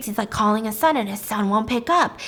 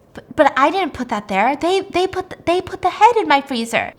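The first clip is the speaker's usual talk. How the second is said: stammering, higher and insistent; flustered, raised-pitch denial; panicked protest; 6 semitones higher, 5 dB louder than usual. Retold or imitated. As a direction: protest your innocence, tripping over words. Panicked protest in an acted exchange.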